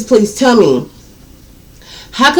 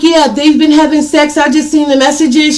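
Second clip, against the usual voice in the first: excited confirming; 6 semitones higher than usual. Retold or imitated. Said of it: "I'm like".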